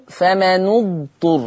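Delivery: slow فمن اضطر as "fama-nu-dturr"; damma on the nun. Shown as a demonstration. Teacher shows Warsh's reading, slow "fama-nu-dturr" for فمن اضطر. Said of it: "لاحظوا الضم".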